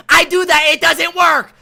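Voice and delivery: high, loud whiny mimicry of a student who won't listen; mock his complaint in a high, loud whine; mocking whiny player. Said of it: "And you say".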